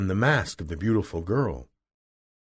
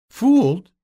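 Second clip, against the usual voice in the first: high, loud cry of disbelief; 14 semitones higher, 8 dB louder than usual. A narrator's reading. Dorothy learning she was fooled, crying out. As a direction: exclaim it, pitched way up. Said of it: "cried Dorothy".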